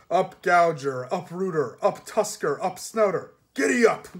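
loud, rising driver's call. Acted. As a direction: shout the team into motion. Driving the pigs.

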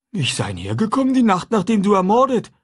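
a simple, eager man talking food; runaway man chatting with the boy; Jim's voice. A narrator's reading.

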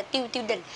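"student" garbled as "tiêu tiêu đình", the s dropped; learners' mispronunciation. Retold or imitated. Teacher offers another learner mistake, "tiêu tiêu đình". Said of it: "Nhiều bạn có đọc là".

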